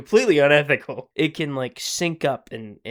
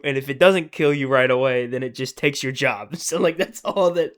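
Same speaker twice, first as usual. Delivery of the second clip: normal talking voice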